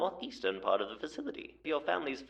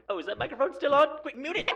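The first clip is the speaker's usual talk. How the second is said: flustered surprise; 13 semitones higher, 4 dB louder, faster than usual. Acted. Caught with microphone still on.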